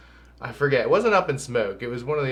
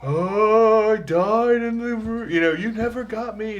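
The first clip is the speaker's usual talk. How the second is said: high, slow ghostly grandma; spooky ghost voice; 10 semitones higher, 4 dB louder, slower than usual. Retold or imitated. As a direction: moan it like a ghost. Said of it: "and then she's like".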